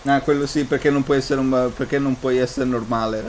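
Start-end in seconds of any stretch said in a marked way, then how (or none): none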